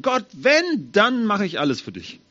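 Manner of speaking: high, loud pleading to God; a mock desperate vow; pleading prayer. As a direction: beg God with a promise. Adults praying promise to God.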